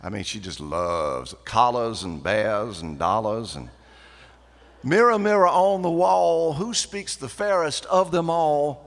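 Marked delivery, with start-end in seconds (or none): 4.8-8.8 high, loud, slow refined voice; posh country club voice. Retold or imitated. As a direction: put on the sister's country club airs. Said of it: "she talks like this".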